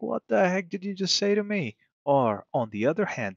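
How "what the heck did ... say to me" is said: baffled reply. Retold or imitated. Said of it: "The Arabic guy is gonna be like".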